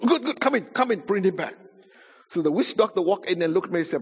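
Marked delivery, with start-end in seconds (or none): 0.0-1.5 high, eager opening, then settling; eager welcome. Retold or imitated. Grandfather welcomes witch doctor to retrieve grandson, eager. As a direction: usher him in eagerly.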